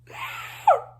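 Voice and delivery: high breathless shriek of a laugh; squealing laugh. Real, not acted. Fan reacting to Annabeth's line, squealing laugh. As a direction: let out a delighted shrieking laugh.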